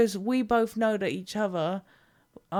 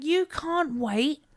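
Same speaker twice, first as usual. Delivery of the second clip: exasperated; 4 semitones higher, 5 dB louder than usual. Retold or imitated. Retold exasperated reply.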